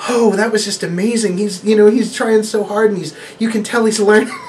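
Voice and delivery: high, sympathetic mock gushing; gushing admiration. Retelling passengers' praise of struggling gondolier, high mock gushing.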